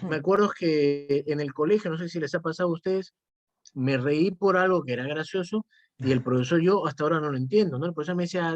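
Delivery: normal talking voice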